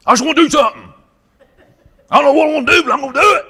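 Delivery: high, loud, laughing eager blurt; hyper hothead. Acted. A reckless man with no wisdom, wound up and eager. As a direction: play a pumped-up fool itching for action.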